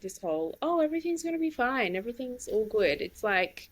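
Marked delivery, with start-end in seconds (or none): 0.6-3.0 high, quick glib reassurance; mock reassurance. Friend mimicking people who deny the hurt, mock reassurance. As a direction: parody someone brushing problems aside.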